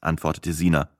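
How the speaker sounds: ordinary delivery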